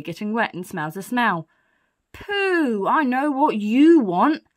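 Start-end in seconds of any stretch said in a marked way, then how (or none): 2.1-2.9 high, loud, falling cry of disgust; disgusted exclamation. Acted. Burglar smells the dirty nappy. 2.9-4.4 loud, slow, raised declaration; big storybook burglar voice; knowing burglar. Acted. Burglar realises what baby needs.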